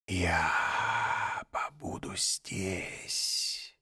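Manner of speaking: slow, soft snake whisper; tender hiss. A narrator's reading. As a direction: soothe him like a snake. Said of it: "нежно прошипела она".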